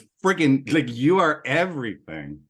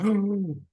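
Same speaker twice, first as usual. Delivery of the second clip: sharp, high intake of breath; alarmed gasp; 7 semitones higher than usual. Acted. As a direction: gasp as dread hits. Acts out the father's alarm.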